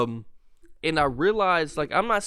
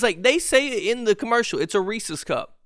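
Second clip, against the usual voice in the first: ordinary talk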